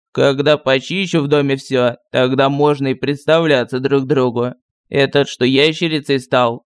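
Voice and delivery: lightly voiced village sorcerer; gruff sorcerer voice. A narrator's reading. Sorcerer voice held. (ворчливый голос колдуна)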